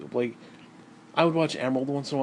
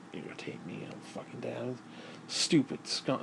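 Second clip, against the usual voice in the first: hushed, breathy muttered curses; low muttered cursing to himself; angry mutter; 3 semitones lower, 11 dB quieter than usual. Real, not acted. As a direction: grumble it under your breath.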